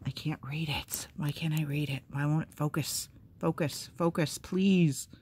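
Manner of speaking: whispered grumble to herself; whispered annoyance at the camera; frustrated whisper. Real, not acted. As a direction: mutter it under her breath. Struggling with phone focus.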